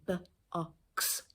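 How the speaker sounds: b, o, x broken into separate sounds; sounding out. Shown as a demonstration. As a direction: sound out "b, o, x" before blending. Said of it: "we have to sound out".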